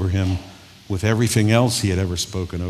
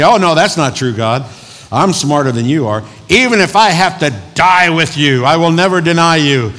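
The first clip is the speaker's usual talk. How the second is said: loud, raised-pitch vow; cocky protest; 7 semitones higher, 8 dB louder than usual. Acted. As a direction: argue back with overblown confidence. Peter arguing back at God.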